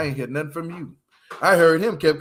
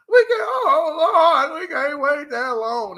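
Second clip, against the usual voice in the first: higher, louder, slow mock cry; imitating Monique; 8 semitones higher, 5 dB louder, slower than usual. Mocking Monique begging to leave, a slow high wail. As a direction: drag it out like a hammed-up sob. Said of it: "That's Monique".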